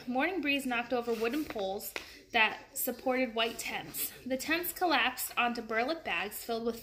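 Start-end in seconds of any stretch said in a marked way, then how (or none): none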